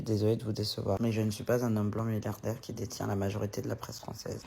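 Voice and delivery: low, measured read-aloud; reading out reply. Acted. Vlogger reads her reply to a message, composed. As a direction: read your written answer in a low, even voice.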